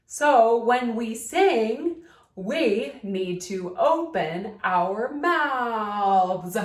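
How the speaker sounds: over-articulate every word with the jaw dropped; slow puppet-style enunciation demo; exaggerated enunciation. Shown as a demonstration. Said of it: "enunciate the words by opening your mouth".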